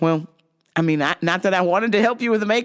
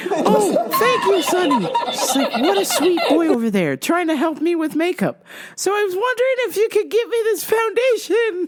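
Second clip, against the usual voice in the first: put-on sweet old grandma; 11 semitones higher than usual. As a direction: play a tiny sweet grandma asking politely. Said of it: "The lady's like".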